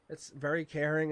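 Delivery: ordinary delivery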